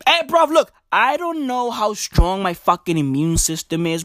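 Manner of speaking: ordinary talk, nothing marked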